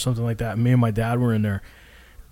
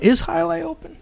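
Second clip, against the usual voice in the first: much higher, teasing parrot of the question; mock squeaky echo. Brother mockingly echoes his sibling's question, squeaky high. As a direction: squeak his words back at him, mocking.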